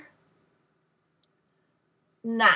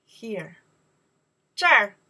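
ordinary talk, nothing marked

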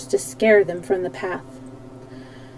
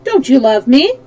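louder, higher question; confident appeal, voice raised; more confident; 4 semitones higher, 6 dB louder than usual. A narrator's reading. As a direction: ask boldly, expecting a yes. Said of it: "with more confidence".

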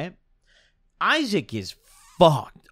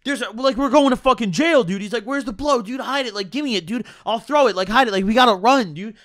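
panicked friend; 6 semitones higher than usual. Retold or imitated. Imitating a friend panicking at a cop, high and frantic. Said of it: "he loses his fucking mind".

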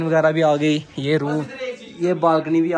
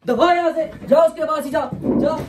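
high-pitched, heated order to leave; angry dismissal; 10 semitones higher than usual. Real, not acted. Husband sending wife off to her ex, angry. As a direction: send them off in a heated outburst.

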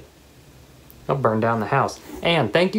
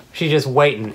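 ordinary talk, nothing marked

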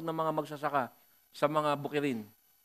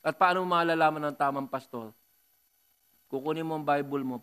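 ordinary talk, nothing marked

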